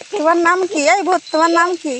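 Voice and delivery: high, loud questioning; loud demand. Acted. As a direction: shout the question at a ghost. Loudly questioning the ghost. (চড়া গলায় জোরে জেরা)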